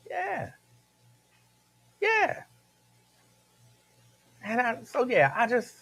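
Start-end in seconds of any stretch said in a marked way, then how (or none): 0.1-2.4 put-on tiny, high, soft kid voice; meek child. Kid giving in to mama's lecture, high and meek. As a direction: squeak out a small reluctant agreement. Retold child meekly agreeing with mama.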